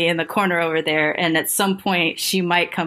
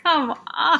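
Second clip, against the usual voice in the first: high, drawn-out protest; exasperated; 10 semitones higher than usual. Real, not acted. Teller reacting to the repeated question, exasperated. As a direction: plead in disbelief at the nerve of it.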